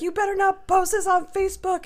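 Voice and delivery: very high, loud mock threat; imitated screaming match. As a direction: act out shouted warning from the posse. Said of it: "I got into a screaming match".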